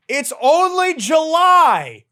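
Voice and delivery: high, slow, drawn-out wail; loud, anguished howl; exasperated yelling. Acted. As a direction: yell it in despair, stretching each word. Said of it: "I've been yelling for the past five minutes".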